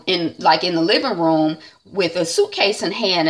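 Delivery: ordinary delivery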